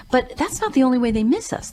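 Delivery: ordinary talk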